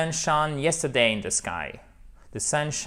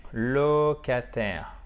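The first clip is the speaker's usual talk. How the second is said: each syllable drawn out slowly; slow clear model word; much slower than usual. Shown as a demonstration. Teacher modelling locataire, drawn out slowly. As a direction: say it slowly for learners to copy. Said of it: "c'est le locataire".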